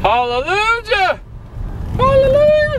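high, drawn-out cry of relief; joyful shout. Real, not acted. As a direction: cheer it out like a praise hymn. Arrival after a brutal drive.

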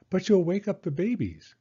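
mother reminding about babies; gently warning mother. Acted. Mama warns Penny about the babies, lightly hushing. Mama's voice.

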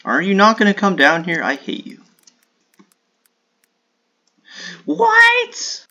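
real frustrated shout; frustrated yell. Real, not acted. Yelling at opponent.

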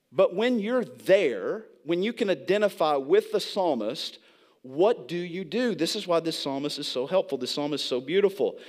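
ordinary delivery

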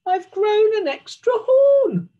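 high, loud unicorn cry; whinny the words out high and loud. Acted. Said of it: "and whinnied".

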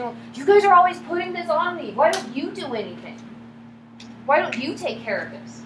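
defensive attack. Acted. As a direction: snap the blame back onto them. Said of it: "I could be saying".

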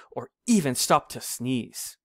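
ordinary delivery, nothing marked

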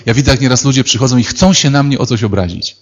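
ordinary talk

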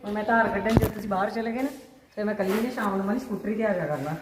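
ordinary talk

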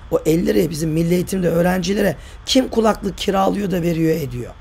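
ordinary talk, nothing marked

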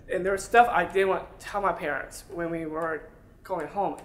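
ordinary talk, nothing marked

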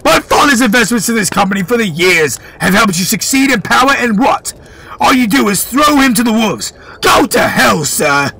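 high, defiant voice; boy's high defiant scolding; defiant accusation. Acted. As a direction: accuse him to his face. Boy keeps accusing, higher voice.

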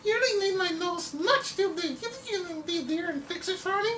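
very high, sweet falsetto damsel; swooning cartoon lady. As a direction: gush like a rescued heroine. Drawing now a lady character.

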